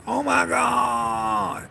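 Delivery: slow, drawn-out shocked cry; stretched-out horror. Real, not acted. Imagining a hacked car kidnapping her, in slow shocked horror. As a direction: drag out oh my god in horror.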